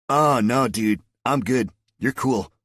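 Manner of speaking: loud, laid-back Southern California surfer drawl; breezy So-Cal surfer dude. Acted. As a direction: chill California surfer reassurance. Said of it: "sounding like a pure Southern California surfer guy".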